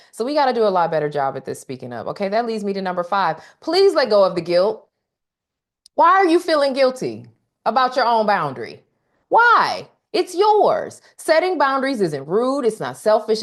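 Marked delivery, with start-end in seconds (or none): 3.6-13.4 urgent high pleading. Real, not acted.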